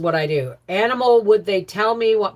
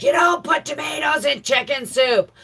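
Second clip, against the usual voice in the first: quoting Howie; 8 semitones higher than usual. Retold or imitated. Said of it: "Howie's like".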